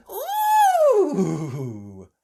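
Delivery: high loud squeal of delight. Acted. Peppa seeing the mermaid costume, delighted squeal. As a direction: squeal with glee. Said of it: "she squealed in delight".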